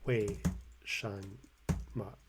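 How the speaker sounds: 为, 什, 么 said one at a time with pauses; syllable by syllable. Shown as a demonstration. Showing viewers the Pinyin, syllable by syllable. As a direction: spell out 为什么 slowly by syllable. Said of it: "in Pinyin, this would be".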